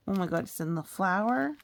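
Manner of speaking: ordinary delivery, nothing marked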